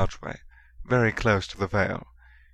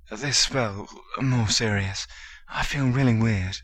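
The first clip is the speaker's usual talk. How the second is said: usual speaking voice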